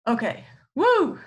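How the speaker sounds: excited cheer. Real, not acted. Cheering herself on between topics.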